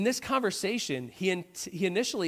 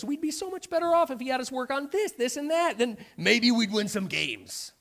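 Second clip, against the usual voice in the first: whiny complaining; 4 semitones higher than usual. Pretend. A complainer blaming boss, coach and sister, whiny. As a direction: gripe about everyone else.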